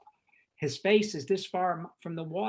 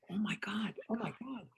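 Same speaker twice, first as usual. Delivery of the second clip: softer, fading to an unvoiced whisper; stunned whisper; 3 semitones higher, 7 dB quieter than usual. Director stunned that Friedkin wants him, whispering. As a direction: murmur it in disbelief. Retelling his stunned reaction.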